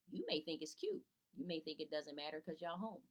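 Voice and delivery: ordinary talk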